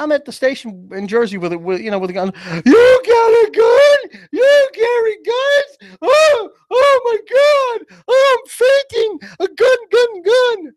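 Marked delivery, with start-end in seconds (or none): 2.7-10.7 very high, drawn-out hysterical wail; mock panicked shriek. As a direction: overplay a fainting fit, loud and squealing. Mocks a gun-shy mom panicking.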